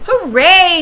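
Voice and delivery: high, loud hooray; cheering Cat. A narrator's reading. Cat celebrating its share, high loud cheer.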